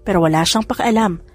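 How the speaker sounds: plain reading voice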